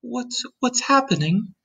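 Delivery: put-on high, startled child's question; alarmed cry. A narrator's reading. James leaping out of the hammock, alarmed cry. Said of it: "cried James".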